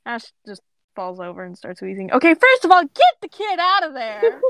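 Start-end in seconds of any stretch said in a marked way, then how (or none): 2.1-4.4 high, loud indignant protest; urgent, raised-pitch objection; exasperated outburst. Real, not acted. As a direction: burst out in real exasperation. Reader protests at the story.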